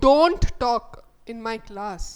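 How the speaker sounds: Don't punched hard and loud, rest lighter; stern classroom order with Don't hit hard; stress on don't. Shown as a demonstration. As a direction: slam the word Don't like a strict teacher. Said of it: "don't కి stress వేస్తున్నాం".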